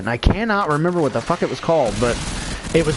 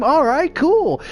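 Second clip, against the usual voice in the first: much higher, bright and keen; eager yes; 11 semitones higher, slower than usual. Retold or imitated. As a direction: jump at the offer, high and keen. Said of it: "and he's like".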